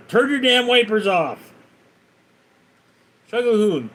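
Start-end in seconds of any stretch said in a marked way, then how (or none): 0.1-1.5 higher, louder yell at the driver; angry shout. Retold or imitated. Retelling how the guy yelled at the truck driver, shouting. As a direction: bark it at the truck driver over the rain. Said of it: "He tells the driver".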